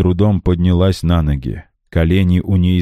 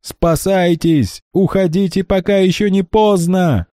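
desperate shout; 13 semitones higher than usual. A narrator's reading. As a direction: scream at them to flee. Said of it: "кричал Мармузе". (отчаянный крик)

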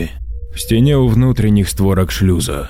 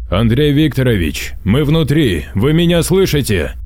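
raised voice calling over radio; 6 semitones higher than usual. Acted. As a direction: call out to someone unanswering. Вызывает по связи. (громко, вызывая по связи)

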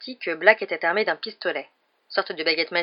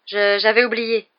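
stammered excuse, louder on the words; flustered apology; 3 semitones higher, 7 dB louder, slower than usual. A narrator's reading. Harry excuses his slip to Stan, flustered. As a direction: stumble into the excuse, slightly flustered. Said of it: "répondit précipitamment Harry".

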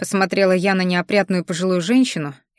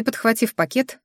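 usual speaking voice

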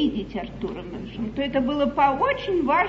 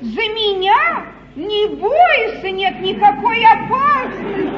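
emphatic reassurance; 7 semitones higher, 8 dB louder, slower than usual. Acted. Королева успокаивает испуганную Абигайль.

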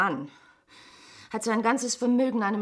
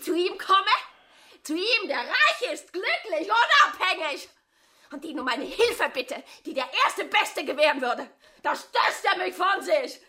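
tearful outburst; 5 semitones higher than usual. Acted. Furious tearful accusation, building.

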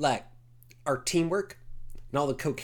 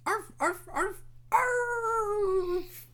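yappy chihuahua voice; much slower than usual. Acted. Small dog barking.

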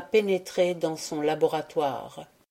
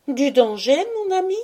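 high, rising disbelief on ami; a wife echoing her husband's warning, astonished; surprised echo; 9 semitones higher, 5 dB louder than usual. A narrator's reading. Georgina echoes in surprise.

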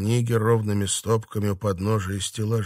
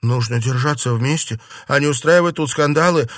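earnest plea building louder and higher; raised-voice appeal for unity; exclaiming; 5 semitones higher, 6 dB louder than usual. A narrator's reading. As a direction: exclaim it as an urgent appeal to companions. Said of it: "воскликнула София".

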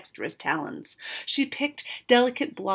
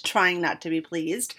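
ordinary delivery, nothing marked

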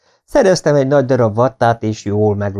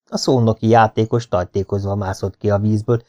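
plain reading voice

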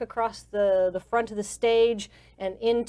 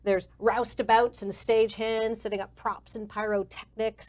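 ordinary delivery

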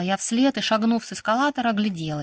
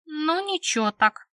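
slow, higher, careless drone; drawn-out couldn't-care-less answer; slacker indifference; 7 semitones higher, slower than usual. A narrator's reading. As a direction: shrug it off in a lazy dunce's tone. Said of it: "не меняя ни тон голоса, ни разгильдяйский пофигизм".

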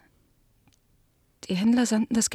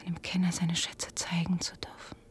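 soft pleading whisper; whispered plea; 8 dB quieter than usual. Acted. Daughter begging the guest to keep her father's illusion, whispered. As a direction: beg gently under the breath.

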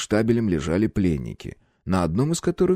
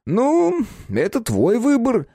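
smirking; 14 semitones higher, much slower than usual. A narrator's reading. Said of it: "ухмыльнулся приятель".

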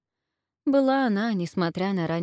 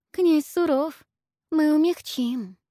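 giggly, much higher, slow and coy; femme fatale; 9 semitones higher, 4 dB louder, slower than usual. A narrator's reading. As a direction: tease him with a giggle. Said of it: "хихикнула вторая". (хихикающая соблазнительница)

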